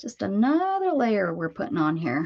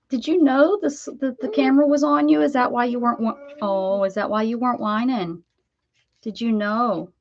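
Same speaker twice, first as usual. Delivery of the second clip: pet talk; 4 semitones higher than usual. Acted. Cooing at the dog.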